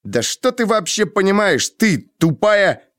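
high-pitched insulting outburst; shamed anger. A narrator's reading. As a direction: lash out, stung with shame. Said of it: "Я вспыхнул от стыда". (вспылив, зло и обиженно)

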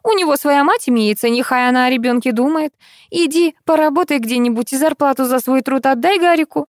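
high-pitched scolding; noisy complaining. A narrator's reading. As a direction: kick up a fuss at your son. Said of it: "зашумела Кузнецова". (шумно возмущаясь, высоким голосом)

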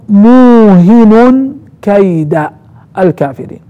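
موهن drawn out slowly, ending in -un; nunation on موهن. Shown as a demonstration. Teacher demonstrating a reading, nunated موهن. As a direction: read موهن as muuhinun, then كيد as kayda. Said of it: "يعني بالضم النون، آه، بالتنوين".

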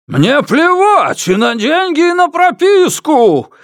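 very high, slow, loud outburst; hysterical yell. A narrator's reading. Father rejects the offer of money, hysterical yell. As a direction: scream it out, stretching the words. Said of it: "истерично заорал Ма Дуньчэнь".

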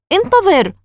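high, sudden exclamation; urgent call. Acted. Margaret pulling free of her brother, urgent call. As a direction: call out sharply to stop him.

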